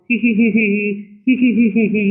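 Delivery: a bratty hee-hee giggle; mocking laugh. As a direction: snicker at her like a bully. Bully laughing at Maya again.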